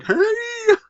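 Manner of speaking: very high, drawn-out falsetto "hey"; mimicked cry. Movie commentator gleefully echoing a moment he loves, high mimicked cry. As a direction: squeal it out in imitation.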